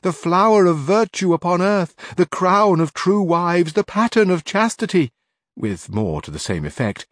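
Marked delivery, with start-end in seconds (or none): 0.0-5.1 high, slow mock lament, much higher and louder; hammed-up wailing. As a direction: wail as a fake grieving maid. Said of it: "began to weep bitterly".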